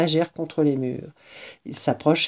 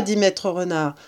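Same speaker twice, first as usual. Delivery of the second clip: ordinary delivery, nothing marked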